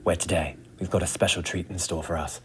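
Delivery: hushed nature documentary narrator; soft, low wildlife narrator voice. Acted. Nature documentary parody opening.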